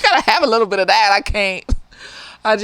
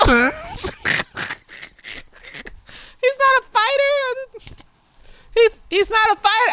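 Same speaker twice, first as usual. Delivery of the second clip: mocking; 9 semitones higher, slower than usual. Mocking the boyfriend.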